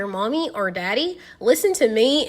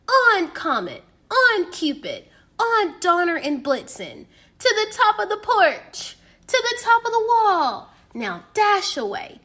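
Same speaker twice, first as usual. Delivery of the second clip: higher, ringing call of each name; calling out to reindeer; 7 semitones higher than usual. A narrator's reading. Saint Nick calling his reindeer by name, ringing higher call. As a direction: call the team out across the roof. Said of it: "he whistled and shouted and called them by name".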